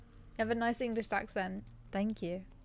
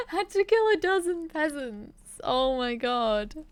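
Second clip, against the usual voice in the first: laughing; 6 semitones higher, 6 dB louder, slower than usual. Real, not acted. Laughs at own game mishap.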